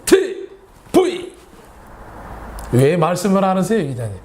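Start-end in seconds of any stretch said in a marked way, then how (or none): 0.1-1.3 announcer call. Acted. Show opening jingle call.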